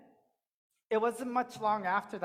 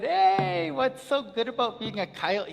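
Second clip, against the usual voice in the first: loud, high hey; calling out a question; 5 semitones higher, 6 dB louder than usual. Acted. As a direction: call out teasingly to coyote. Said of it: "you said".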